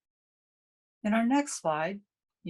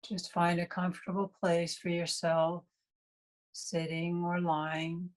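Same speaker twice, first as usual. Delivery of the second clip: guided meditation. Real, not acted. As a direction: guide them through breath awareness, leaving long silences between phrases.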